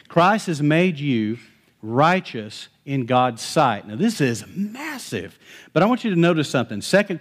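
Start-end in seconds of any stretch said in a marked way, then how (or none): 0.1-3.9 slow, deliberate proclamation; slow emphasis. Real, not acted. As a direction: lay each word down slowly. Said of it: "Listen to this".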